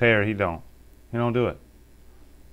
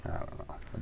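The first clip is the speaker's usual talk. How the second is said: whisper; 18 dB quieter, much faster than usual. Real, not acted. Uneasy aside about saying bruh.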